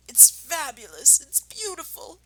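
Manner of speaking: rising breathless rapture; breathless awe. Acted. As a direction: gasp it in awe. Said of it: "fighting for breath".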